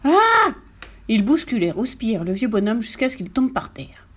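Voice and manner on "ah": loud, high-pitched cry of alarm; high scream. A narrator's reading. Farmer as his bed is lifted, high loud scream. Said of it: "se mit à crier".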